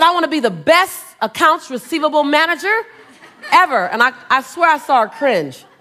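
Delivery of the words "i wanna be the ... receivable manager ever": a touch louder on ever, eager and proud; eager young self. Retold or imitated. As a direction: pitch it like an earnest young go-getter.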